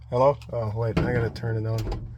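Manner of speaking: normal talking voice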